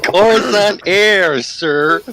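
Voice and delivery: put-on nasal kid voice. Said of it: "You sound so nasally".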